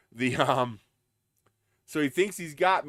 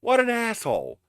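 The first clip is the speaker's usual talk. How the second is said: higher, louder exclamation; indignant; 8 semitones higher, 4 dB louder than usual. Real, not acted. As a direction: spit out the insult. Angry exclamation.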